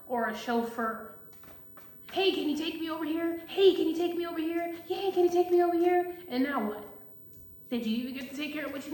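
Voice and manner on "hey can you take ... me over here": higher-pitched, repeated needy request; higher mock pleading; pestering favor-seekers. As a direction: mimic people hounding you for rides. Mimics people pestering for favors.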